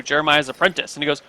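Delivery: ordinary talk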